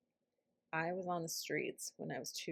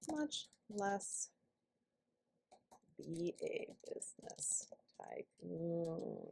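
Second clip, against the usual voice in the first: slow whisper; 3 dB quieter, much slower than usual. Shown as a demonstration. Intuitive answer, whispered.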